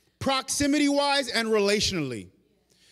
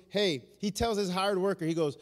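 ordinary delivery

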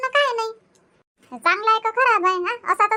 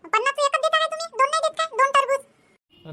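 put-on high boy's voice, cheeky question; small boy bargaining innocently; child asking the vendor; curious child; 6 semitones higher than usual.

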